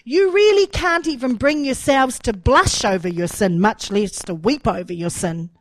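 loud, forceful prophetic reproach; preacher's rebuke. Acted. Preacher quoting Jeremiah on shallow repenters, loud reproachful proclamation. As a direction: drive the scolding verse home loudly.

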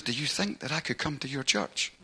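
soft, tentative request; quiet, confiding question; very quietly. Retold or imitated. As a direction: ask it hushed and discreet. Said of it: "very quietly".

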